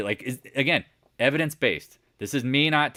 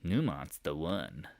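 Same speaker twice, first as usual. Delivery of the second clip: a touch of a dopey know-it-all, slow and softer; lightly voiced gullible investor; mock naive reader; 5 semitones lower, 9 dB quieter, slower than usual. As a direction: play the clueless magazine reader. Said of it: "they'll go".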